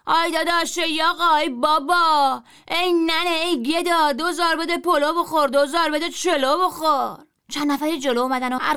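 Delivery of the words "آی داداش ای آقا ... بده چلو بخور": louder, higher drawn-out street cry; hawker shout. A narrator's reading. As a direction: call the whole square over. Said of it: "دوباره داد زدم". (جار بلند و کشیده فروشنده)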